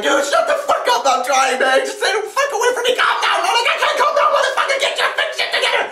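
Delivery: very high, strained frantic screaming; acted-out hysterical shouting match; screaming argument. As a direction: yell back and forth, tumbling into a fast rant. Acted screaming argument between teammates.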